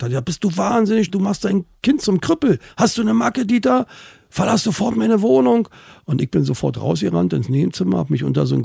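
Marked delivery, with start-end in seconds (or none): 0.2-5.7 high, loud outraged scolding; furious grandfather. Retold or imitated. Grandfather throws the father out, high furious shout.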